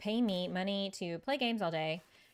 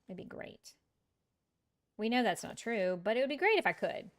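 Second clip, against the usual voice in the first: ordinary talk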